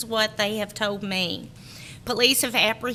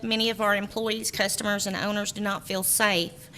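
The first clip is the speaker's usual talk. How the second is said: normal talking voice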